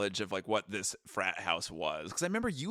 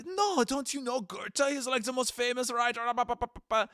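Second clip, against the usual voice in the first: imitating an appalled friend, high-pitched; mock-incredulous protest in a high put-on voice; disturbed; 13 semitones higher than usual. German friend appalled by the guest's ignorance, disturbed. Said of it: "Johannes was very disturbed".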